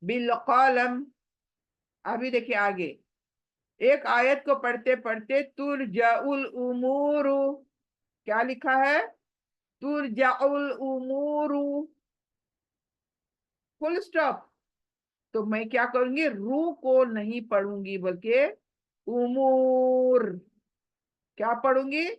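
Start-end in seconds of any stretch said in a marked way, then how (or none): 0.0-1.0 बिल कलम closed on a silent meem; stopped meem. Shown as a demonstration. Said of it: "क्योंकि हम stop कर रहे हैं". 19.1-20.2 उमूर stretched slowly, final र silent; drawn out stop. Shown as a demonstration. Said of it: "खींच कर stop करूंगी".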